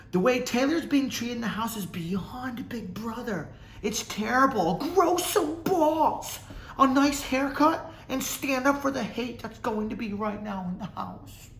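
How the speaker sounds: very high put-on whine, drawn out; mock-outraged commenter. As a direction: read it in a squeaky, hammed-up outrage.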